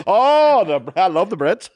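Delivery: loud, high put-on gushing; mock Brit-lover. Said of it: "He's heading back to London".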